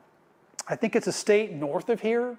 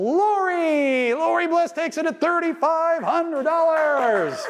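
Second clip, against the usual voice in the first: much higher, slowed, excited announcer call; winner announcement; 8 semitones higher, slower than usual. Real, not acted. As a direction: proclaim the winner with delight. Auctioneer announces the winning bidder.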